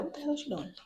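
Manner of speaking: high mock complaint, held back; mock neighbor. Imagining the downstairs neighbor grumbling, a light high mock voice. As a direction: play the annoyed neighbor below, lightly. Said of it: "my downstairs neighbor's gonna be like".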